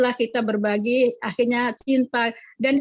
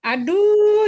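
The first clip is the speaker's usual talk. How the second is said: high, loud exclaimed aduh; teacher exclaiming over pupils spitting on her; 10 semitones higher, 6 dB louder than usual. Real, not acted. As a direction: cry it out in disbelief. Recalling being spat on.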